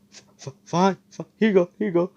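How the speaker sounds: high, loud panicked stutter; scared stammer. Retold or imitated. Caught thief handing back the money in panic. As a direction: cave in terrified, tripping on words. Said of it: "And he's like".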